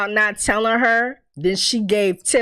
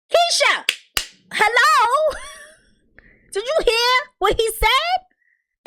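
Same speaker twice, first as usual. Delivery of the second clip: high, incredulous call to someone zoned out; mock calling out; slower than usual. Viewer calling to Keisha frozen in shock, mock exasperated. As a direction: call out in exaggerated disbelief, voice pitched way up.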